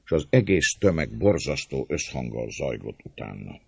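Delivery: ordinary delivery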